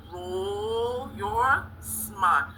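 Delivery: very slow, stretched class chant; drawn-out cheer. Pretend. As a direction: stretch every word as a cheer.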